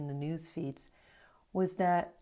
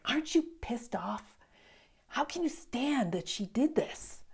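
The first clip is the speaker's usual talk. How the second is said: higher, hushed urgent outburst; indignant young monk; 6 semitones higher than usual. Acted. Young novice to his master, blurting out his outrage, higher and hushed. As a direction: blurt it out in disbelief, voice pitched up.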